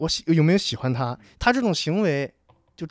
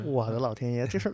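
ordinary delivery

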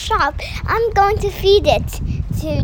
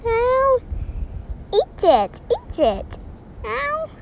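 soft cat meows; 6 semitones higher, 7 dB quieter, slower than usual. Acted. Child keeps meowing.

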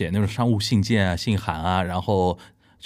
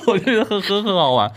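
talking through laughter, higher; laughing; 10 semitones higher than usual. Real, not acted. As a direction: still giggling while speaking. Amused at classroom of foreigners.

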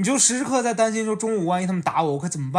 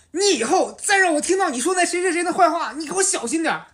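high, hammed-up warning from the friend; mock threat; 9 semitones higher than usual. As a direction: play the friend growling a threat, pitched up. Friend threatens him after kick. (朋友威胁他：高声的夸张恐吓)

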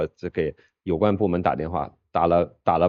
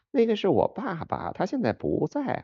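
put-on childlike voice; 9 semitones higher than usual. Imitating child on the phone.

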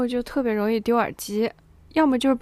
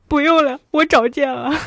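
retold loud refusal; 3 semitones higher, 7 dB louder than usual. Retold curt refusal to dad.

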